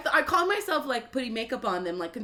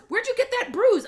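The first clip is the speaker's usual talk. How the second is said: mock motherly alarm, pitched way up; questioning mom; 13 semitones higher, 7 dB louder than usual. Mom questioning daughter in the car, alarmed. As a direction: imitate a high, loud, probing mother.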